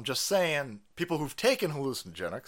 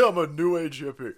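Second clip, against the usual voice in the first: loud, laughing mock declaration; playful self-mocking announcement; mock proclamation; 3 dB louder than usual. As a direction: proclaim it louder, hamming it up. Repeats it as hammed-up declaration.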